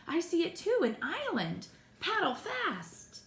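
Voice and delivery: high, thrilled call; high-pitched excitement, stretched at the end; excited urging. A narrator's reading. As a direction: spot land and urge them on. Sighting land, urging to paddle.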